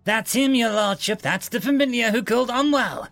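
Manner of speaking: high-pitched, urgent finger-pointing; urgent accusation. A narrator's reading. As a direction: denounce the culprit to a superior.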